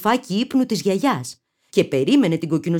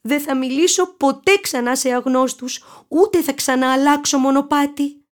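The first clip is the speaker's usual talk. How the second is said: put-on girl voice; 8 semitones higher than usual. A narrator's reading.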